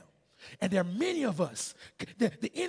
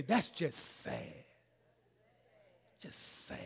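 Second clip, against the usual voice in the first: scolding; much slower than usual. Retold or imitated. Preacher has neighbors echo Big Mama, slow scolding. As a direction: imitate a grandmother's slow, disappointed reproach. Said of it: "and her scolding words".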